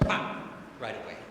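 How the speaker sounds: ordinary delivery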